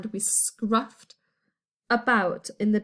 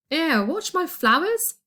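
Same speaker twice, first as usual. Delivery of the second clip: higher, louder grumpy neighbour voice; grumbling; 7 semitones higher, 4 dB louder than usual. A narrator's reading. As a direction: complain like a fussy old gardener. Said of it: "Mister Croquin grumbled".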